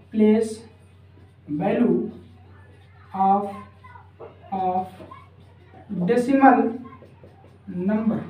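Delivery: one word at a time, long pauses between; word-by-word dictation. Real, not acted. Teacher giving students the decimal heading to write, word-by-word dictation.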